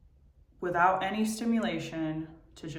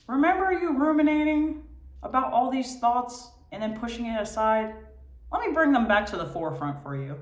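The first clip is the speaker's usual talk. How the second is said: high, fast, pestering brain voice; nagging inner voice; 7 semitones higher, 7 dB louder than usual. Retold or imitated. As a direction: nag like a restless mind. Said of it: "I'm like".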